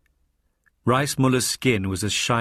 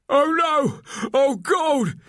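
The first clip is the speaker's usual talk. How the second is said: high, far higher terrified cry as she wakes; 3 dB louder, much slower than usual. Acted. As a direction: shriek it out in sudden terror. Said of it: "she cried".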